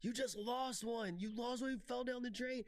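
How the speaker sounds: retelling a video, a man's high mock yell at a duck; mock scream. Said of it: "he's screaming at the duck".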